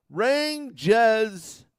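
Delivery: mock the New York way of saying "Rangers"; New York accent. Mocking how Rangers fans say it in a New York accent. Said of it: "That's how they pronounce it".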